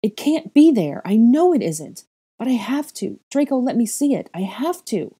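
very high loud desperate cries; cling on and plead; frantic. A narrator's reading. Girl clawing at his cuff, high frantic.

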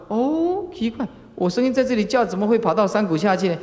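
high, fast, baffled; puzzled fool. Acted. Preacher voices the fool baffled by his echo, high and quick.

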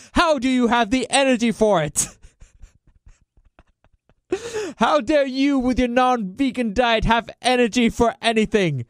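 high-pitched, hammed-up indignant rant; mock outrage. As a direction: drag out each accusation in theatrical horror.

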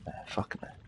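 whispered refusal. Real, not acted. Gamer whispering no to jumping in the water. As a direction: breathe it out with no voice.

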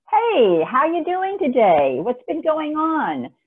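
high, loud, warm-up hello that slows into small talk; friendly chatty greeting. Shown as a demonstration. To a chatty coworker, a high bright friendly hello. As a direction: sound delighted to catch up, drawing the words out. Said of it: "And talk to her for a minute or two".

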